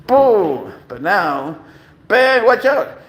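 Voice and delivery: "watch out" called out loud; excited exclamation. Real, not acted. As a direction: burst out like an announcer. Hyping the Bengal's wildness.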